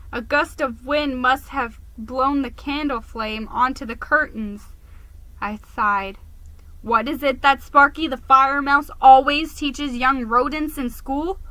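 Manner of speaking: usual speaking voice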